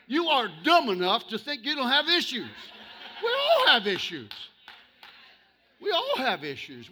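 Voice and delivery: loud, rising sermon voice, near shouting; full-force preaching. Acted. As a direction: preach it to the back row.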